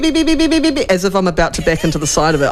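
sensor beeps. Retold or imitated. Imitating reversing sensor.

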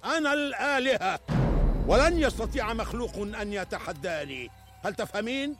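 loud, commanding tyrant boasting; arrogant shout. Acted. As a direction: bellow it like a god-king. King declares himself god.

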